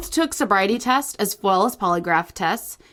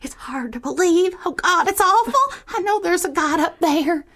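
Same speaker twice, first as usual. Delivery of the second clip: put-on Southern accent; 7 semitones higher than usual. Improvising an alien abduction witness, Southern accent. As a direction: play a rattled Southern witness. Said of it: "If you like our Southern accent".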